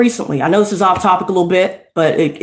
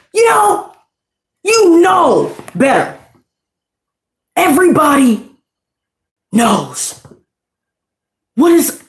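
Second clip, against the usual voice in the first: scolding; 5 semitones higher than usual. Real, not acted. Scolding Nick.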